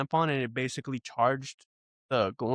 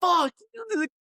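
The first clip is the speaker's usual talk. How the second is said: much higher, slow falsetto panic; mock terrified kid; 4 dB louder, slower than usual. Mocking a scared kid hiding from monsters, falsetto panic. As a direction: squeal like a kid diving under covers.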